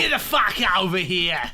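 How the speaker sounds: loud hammed-up Aussie fighter taunt; mock Australian accent. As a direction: bark the catchphrase in a cartoon Australian voice. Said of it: "This Australian accent is choking me".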